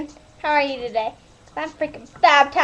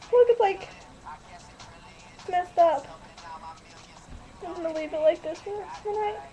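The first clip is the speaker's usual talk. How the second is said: ordinary talk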